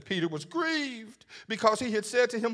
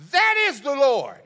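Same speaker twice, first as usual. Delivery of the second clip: excited; 9 semitones higher, 11 dB louder than usual. Retold or imitated. Thomas recognizes Jesus.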